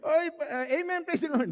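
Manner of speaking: high, loud, laughing praise the Lord. Real, not acted. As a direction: laugh your way through the amen. Laughing through a mock praise.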